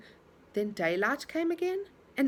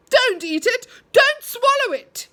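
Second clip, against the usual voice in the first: high, loud, slow alarmed yell; sudden yell; 12 semitones higher, 10 dB louder, slower than usual. A narrator's reading. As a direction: yell it out to stop her. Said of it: "yelled Moonface suddenly".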